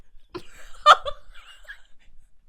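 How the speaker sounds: high breathless laugh. Real, not acted. Friends recalling a movie scene, laughing hard.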